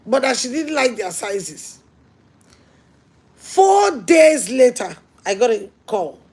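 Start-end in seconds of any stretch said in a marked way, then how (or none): 3.3-5.0 very slow, loud, high shout of the phrase; shouted emphasis. Real, not acted. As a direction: call it out to the whole hall, drawn out. Preacher's climax in a story.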